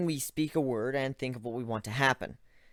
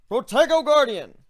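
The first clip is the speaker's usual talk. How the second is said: shouted spell; 12 semitones higher, 11 dB louder than usual. Acted. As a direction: bellow the spell proudly. Ivan shouting spell again.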